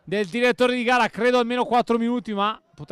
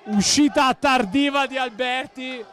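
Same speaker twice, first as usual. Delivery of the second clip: ordinary delivery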